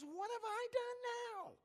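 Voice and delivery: high, drawn-out little-brother protest; exasperated David. Acted. David to his angry older brother, exasperated protest. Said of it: "David looks at him and says".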